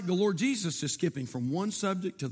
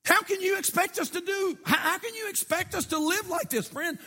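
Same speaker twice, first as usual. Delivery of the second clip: disbelieving protest; 11 semitones higher than usual. Pretend. Voicing disciples' protest.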